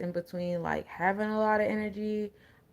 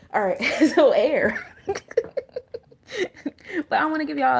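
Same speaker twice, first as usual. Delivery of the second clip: laughing it off, louder and higher; 7 semitones higher, 7 dB louder than usual. Real, not acted. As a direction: burst out laughing while moving on. Laughing off the crying energy.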